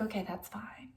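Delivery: soft breathy whisper; a light whispered imitation; whispered reply. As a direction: whisper it like a calm killer. Killer answering her calmly.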